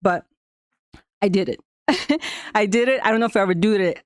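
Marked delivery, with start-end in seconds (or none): 1.9-2.5 short, high bright laugh; burst of laughter. Real, not acted. Proud of finishing the jump, a quick laugh. Laughs after saying she did it.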